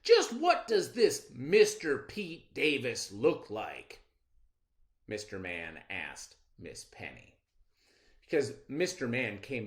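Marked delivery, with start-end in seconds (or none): 0.0-4.0 slow, louder probing question; father quizzing his daughter about the visitor; suspicious father. Acted. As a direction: narrow in on the culprit.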